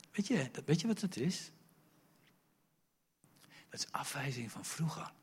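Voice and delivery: normal talking voice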